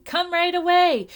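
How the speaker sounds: high, loud urgent calling; shouting. A narrator's reading. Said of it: "shouted Chang".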